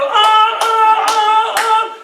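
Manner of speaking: high, loud mock screams of cheering people; imitated crowd cheering. Retelling people cheering him on, high loud screams. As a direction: shriek like the cheering onlookers. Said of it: "the people".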